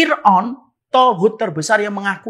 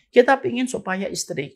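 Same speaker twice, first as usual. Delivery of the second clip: ordinary delivery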